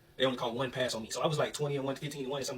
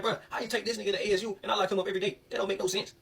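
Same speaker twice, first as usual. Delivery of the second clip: indignant protest; 7 semitones higher than usual. Retold or imitated. Retold protest over coach's player pick.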